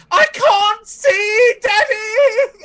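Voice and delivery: very high, loud crybaby wail, slow at the end; whiny child crying for daddy. Acted. Spoiled fop wailing to his father about the paladin. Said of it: "now he's like".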